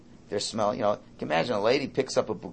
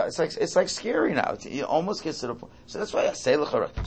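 usual speaking voice

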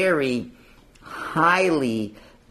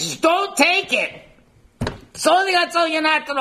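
voice of God; 3 dB louder than usual. Acted. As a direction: proclaim it from far above, pitched high. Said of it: "God says".

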